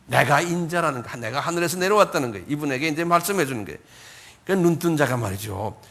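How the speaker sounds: ordinary talk, nothing marked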